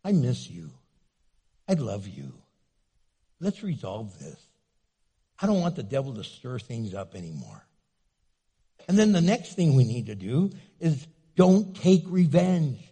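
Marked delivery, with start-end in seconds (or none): 0.0-7.7 reach out gently to heal; softly sincere plea; tender plea. Shown as a demonstration. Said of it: "go and say".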